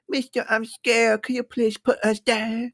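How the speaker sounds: high, slow, timid mock-frightened plea; scared plea. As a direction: beg the balloon man meekly to land. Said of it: "I start to say to the man".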